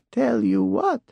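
put-on old woman voice. A narrator's reading. Bat Lady answers.

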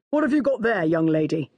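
lightly voiced booming policeman. A narrator's reading. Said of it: "came up to me and boomed".

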